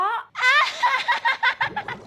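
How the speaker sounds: shrill burst of delighted laughter; gleeful high cackle. Acted. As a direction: cackle in triumph, high and bright. Laughs after finding treasure.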